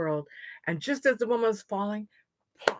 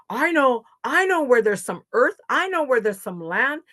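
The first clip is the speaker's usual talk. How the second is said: higher, louder excited little swimmer; eager; 5 semitones higher, 7 dB louder than usual. Acted. Little swimmer tells the others about land, eager. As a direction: burst out with the answer.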